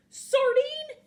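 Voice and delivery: high, loud cartoon outrage; hammed-up offended squawk; indignant outburst. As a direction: shout it back as an affronted question. Piranha hates being called sardine.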